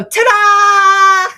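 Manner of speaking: loud, high, drawn-out stage cry; mock triumphant flourish. Friend teases host after the mishap, mock triumphant. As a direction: belt out a mock magician's reveal. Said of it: "waiting for you to go".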